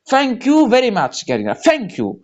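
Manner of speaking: usual speaking voice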